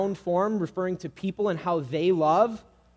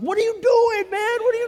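very high, loud outburst; road-rage yell; 8 dB louder than usual. Acted. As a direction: explode at the driver mid-worship. Sudden yell at another driver.

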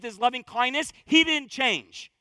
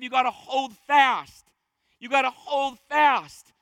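slow, louder, pressing each word; urgent exhortation; 4 dB louder, slower than usual. Real, not acted. Preacher urging the church to hold fast, slow and forceful. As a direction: drive it home to the congregation.

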